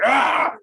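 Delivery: sudden high, loud shout "Ah!"; imitating a brawler yelling before kicking; mock angry yell. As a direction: let out a hammed-up battle shout. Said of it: "Sheamus would just, like".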